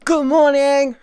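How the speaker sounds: high, loud sing-song good morning; cheery greeting. Real, not acted. Streamer greeting chat, high and loud. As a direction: chirp it up bright to wake the room.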